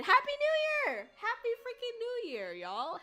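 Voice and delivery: high, loud festive cheer; excited greeting. Real, not acted. Streamer cheering Happy New Year to her chat, high and loud. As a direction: shout the greeting to the room.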